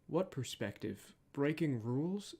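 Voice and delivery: soft, measured hero lines with accent kept; child Batman voice. Shown as a demonstration. Voice actor rehearses child Batman lines, soft with the accent kept. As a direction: try each phrase softly in the young hero's accent. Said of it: "made sure the accent was kept".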